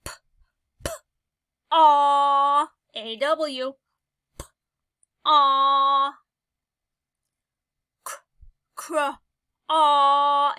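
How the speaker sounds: teacher isolating the final aw loudly; sounding out. Shown as a demonstration. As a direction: stretch and blast the ending aw. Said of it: "if you hear aw at the end".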